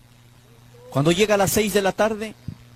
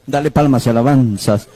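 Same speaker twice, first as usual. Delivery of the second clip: 1 call to praise; 3 semitones lower, 6 dB louder than usual. Real, not acted. Calls congregation to praise.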